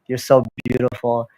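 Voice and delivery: ordinary talk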